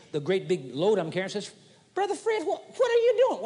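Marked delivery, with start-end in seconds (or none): 1.9-3.4 high, startled friend calling out; surprised friend. Retold or imitated. Billy Joe asks Brother Fred about his load, high and startled. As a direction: play Billy Joe catching sight of the load.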